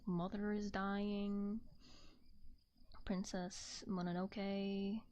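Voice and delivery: usual speaking voice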